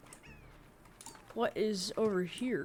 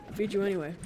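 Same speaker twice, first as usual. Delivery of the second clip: ordinary talk, nothing marked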